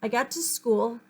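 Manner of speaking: usual speaking voice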